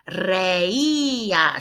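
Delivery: reía drawn out, the í stressed. Shown as a demonstration. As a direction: lean on the í in reía. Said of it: "spelled R E I with an accent".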